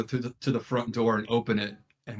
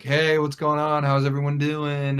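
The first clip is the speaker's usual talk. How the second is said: higher, louder sing-out hello; parents' breezy greeting; 4 semitones higher, 5 dB louder than usual. Retold or imitated. Parents coming home to the kids, breezy greeting. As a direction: act out parents calling a carefree hello.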